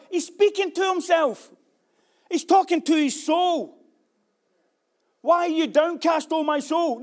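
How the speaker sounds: loud, high preaching voice. Acted. Pastor preaching to the congregation, loud and fervent. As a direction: preach it out to the church.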